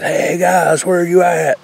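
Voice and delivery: imitating a full-voiced holler, pitched high up; retelling the loudest shout for the guys; top of lungs yell. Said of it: "I yelled top of my lungs".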